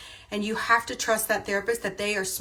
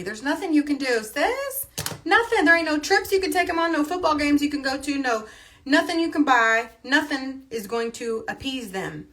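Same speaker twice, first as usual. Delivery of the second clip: sassy emphatic; 7 semitones higher, 5 dB louder than usual. Real, not acted. Sassy rant to viewers, "sis.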